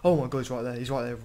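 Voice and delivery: panicked. Real, not acted. Teacher suddenly appears.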